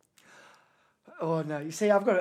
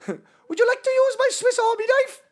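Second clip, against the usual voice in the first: very high, loud fake Swiss; pitched-up Swiss accent; 5 dB louder than usual. Friend retrying Swiss for guessers, absurdly high mock accent. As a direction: squeal it in badly faked Swiss. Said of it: "pitched up and then just talking".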